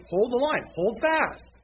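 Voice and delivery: high-pitched, emphatic command; rallying exhortation. Real, not acted. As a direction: urge them to hold, voice lifted high. Preacher repeating rallying commands.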